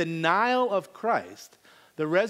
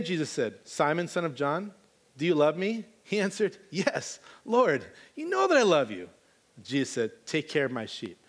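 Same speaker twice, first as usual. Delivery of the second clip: scripture reading; 3 semitones lower than usual. Acted. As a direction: read the verses gently. Reading the passage again.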